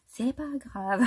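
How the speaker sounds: laughing. Real, not acted.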